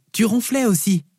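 put-on high-pitched child's voice, teasing; teasing little girl. Daughter telling dad he snored, teasing child voice.

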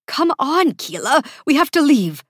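high, anxious pleading; pressing, high-pitched insistence; urgent. A narrator's reading. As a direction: urge a companion to flee. Anxious assistant urges her to flee.

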